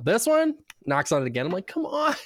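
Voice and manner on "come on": high-pitched mock pleading; retold whiny objection, pitched way up; exasperated protest. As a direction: plead with the dentist in disbelief. Retold patient protesting repeated knocking.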